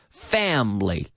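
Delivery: FAMILY in two syllables, middle vowel dropped; two-syllable pronunciation. Shown as a demonstration. Teacher modelling the word for Spanish learners, two syllables. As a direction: say family slowly as FAM-lee. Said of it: "talking about two-syllable words".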